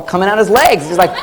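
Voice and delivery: ordinary talk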